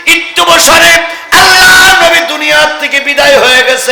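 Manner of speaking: high, loud sermon chant; full-force waz chant; impassioned preaching cry. A narrator's reading. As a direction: cry it out high to the crowd, drawing out the vowels. Preacher cries out the Prophet's passing.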